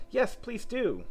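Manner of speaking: high-pitched eager begging; chorus plea. A narrator's reading. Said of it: "all the others joined in chorus".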